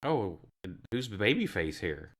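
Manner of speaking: normal talking voice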